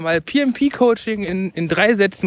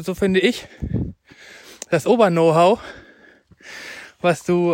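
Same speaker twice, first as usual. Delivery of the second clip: ordinary talk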